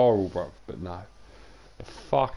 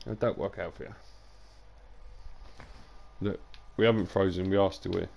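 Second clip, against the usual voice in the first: usual speaking voice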